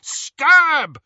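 high, very loud urgent yell; shouted. A narrator's reading. Harry desperately orders Ron to strike, shouting. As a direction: shout the order at full force. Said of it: "shouted Harry".

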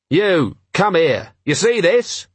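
high-pitched call to order; restrained shout, pitched well up; mild narrated shout. A narrator's reading. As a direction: call the guard over sharply, without full volume. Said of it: "he shouted at the guard".

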